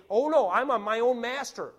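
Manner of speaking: loud, mock self-assured proclamation; boastful declaration. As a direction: proclaim your self-mastery at full volume. Acts boastful self-willed sinner.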